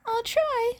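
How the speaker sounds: a hint of a nervous boy confessing; young hero. A narrator's reading.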